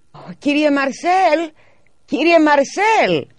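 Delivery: high, slow, loud anguished call. A narrator's reading. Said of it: "έκανε όλο αγωνία". (η μητέρα φωνάζει τον γείτονα με αγωνία)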